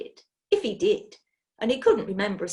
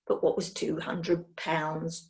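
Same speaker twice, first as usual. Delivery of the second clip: ordinary delivery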